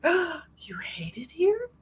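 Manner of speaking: slow, high, breath-filled wounded question; mock hurt gasp. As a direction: gasp and ask as if deeply wounded. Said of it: "my feelings were hurt".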